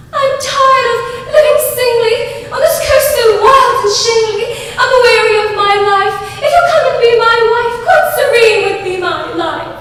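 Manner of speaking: high, fast, loud sing-song English reading; lilting English storybook chant; English nurse reading verse. Acted. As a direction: croon the rhyme like an English nanny. Nurse reads poem aloud.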